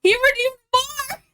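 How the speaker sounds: squeaky high disbelieving yelp; surprised. Real, not acted. Viewer redeems more hydrates.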